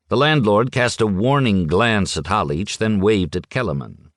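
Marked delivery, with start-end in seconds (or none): none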